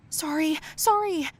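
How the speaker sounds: high, panicky little apology; frightened apology. A narrator's reading. Girl apologizes after spilling coffee on Colin, frightened. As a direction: pitch jumps up in alarm. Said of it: "I said, frightened".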